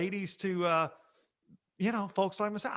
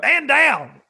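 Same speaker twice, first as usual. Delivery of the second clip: loud, high barked order; mock command; 8 semitones higher, 12 dB louder than usual. Joking about slipping the line into his show, loud command. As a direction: bark it like an officer. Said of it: "I might do some stuff like that".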